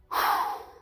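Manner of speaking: long audible breath out; demo exhale. Shown as a demonstration. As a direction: breathe out slowly through the mouth. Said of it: "like that".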